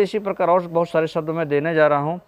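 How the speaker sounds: normal talking voice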